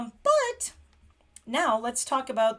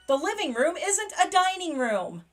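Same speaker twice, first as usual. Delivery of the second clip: delighted translation; 8 semitones higher, 6 dB louder than usual. Real, not acted. Delighted translation, then laughing.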